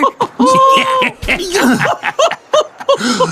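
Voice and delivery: shrieking laugh. Acted. Startled monster laughs.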